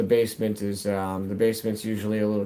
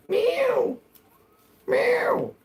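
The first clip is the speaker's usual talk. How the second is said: high-pitched imitation meow; cat meow. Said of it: "I'm teasing my cat".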